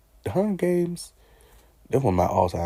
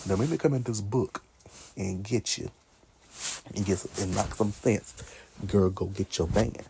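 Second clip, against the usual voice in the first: ordinary talk, nothing marked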